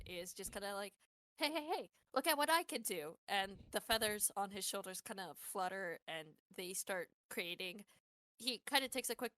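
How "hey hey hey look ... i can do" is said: loud, high call for attention; attention-grabbing showman. Acted. Benji calling out to capture kids' attention, eager showing off. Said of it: "trying to capture their attention".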